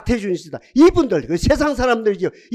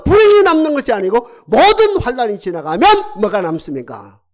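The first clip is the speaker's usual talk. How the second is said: loud, higher, hammering each opening word; preaching at full force; 6 semitones higher, 7 dB louder than usual. Acted. Pastor pressing the congregation about suffering, preaching loudly. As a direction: drive the point home from the pulpit.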